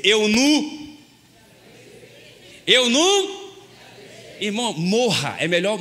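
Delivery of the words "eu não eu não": mocking the wife. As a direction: play the nagging wife, loud and put-on. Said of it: "ela vai dizer".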